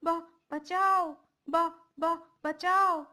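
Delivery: desperate yell for help; cry for help. Acted. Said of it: "शोर मचाने की कोशिश करता है".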